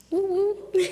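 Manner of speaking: high mock cheer breaking into a laugh; playful woo. Real, not acted. Speaker cheering wryly about moving states, woo then laugh.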